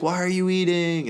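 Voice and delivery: high, drawn-out wife voice; indignant wife. Acted. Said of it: "She's like".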